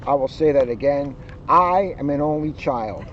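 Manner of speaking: plain reading voice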